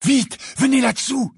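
high, panicky order; urgent. A narrator's reading. Harry hurries friends under the cloak, urgent. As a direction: hurry them with a sharp rise in pitch.

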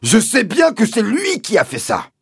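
very high, loud screech; exasperated shout. Acted. Landowner confirming his accusation to the sergeant, high angry shout. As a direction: yell it back in exasperation.

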